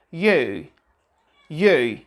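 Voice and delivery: you with a British-style U vowel. Shown as a demonstration. Teacher models British pronunciation of you. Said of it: "They would say".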